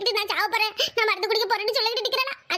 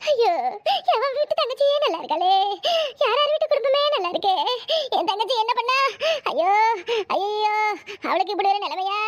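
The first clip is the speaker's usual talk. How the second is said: theatrical weeping complaint; wailing lament. Acted. Bewails sister's fate to husband.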